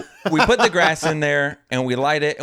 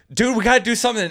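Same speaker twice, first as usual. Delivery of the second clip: panicked plea; 10 semitones higher, 4 dB louder, much faster than usual. Retold or imitated. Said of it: "and I'm like".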